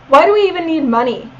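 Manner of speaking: ordinary talk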